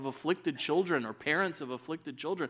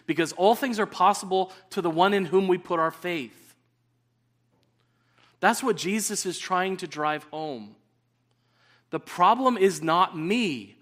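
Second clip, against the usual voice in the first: forceful preaching; 5 semitones higher, 8 dB louder than usual. Acted. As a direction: preach it out with full force, louder.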